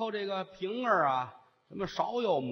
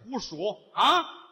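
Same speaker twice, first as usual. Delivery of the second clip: slow loud scolding; 3 semitones higher, 6 dB louder, much slower than usual. Acted. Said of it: "姥姥也生气".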